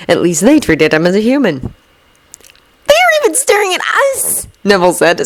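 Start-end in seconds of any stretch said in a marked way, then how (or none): 2.9-4.5 blown away. A narrator's reading. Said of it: "sounding pretty blown away".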